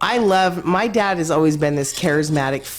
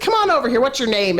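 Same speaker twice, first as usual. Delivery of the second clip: dad calling people over; 7 semitones higher, faster than usual. Retold or imitated. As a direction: call them over boldly. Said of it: "He's like".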